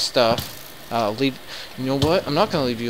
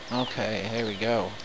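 ordinary talk, nothing marked

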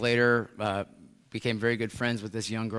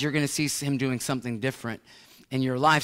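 usual speaking voice

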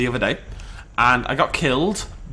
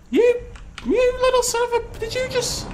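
much higher, outraged sputter at the attacker; sputtering fury, cut short. Real, not acted. Bitten by a T-Rex in the game, high outraged sputter.

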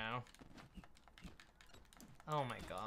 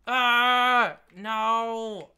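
loud, higher groan of defeat; dismayed cry; 11 semitones higher, 15 dB louder than usual. Real, not acted. Lost in game.

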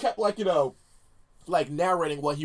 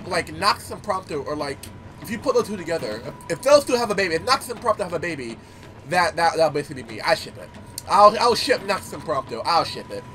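ordinary talk

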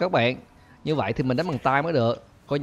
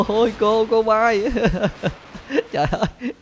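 gleeful, pitched-up outburst, laughing; delighted laughter; 7 semitones higher than usual. Real, not acted. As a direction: crack up at the sight. Teacher flies again after the bomb.

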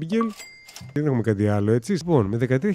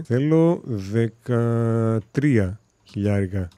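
slow drawn-out demand; much slower than usual. Acted. Stating his asking price.

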